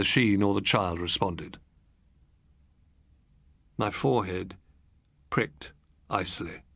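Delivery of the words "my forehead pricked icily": usual speaking voice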